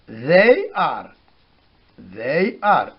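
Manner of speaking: slow, careful "they are" with a voiced th; English th demo. Shown as a demonstration. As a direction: model "they are" slowly for students. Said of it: "Par exemple, on dit".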